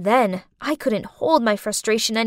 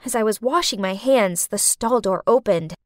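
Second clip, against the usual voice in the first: ordinary delivery, nothing marked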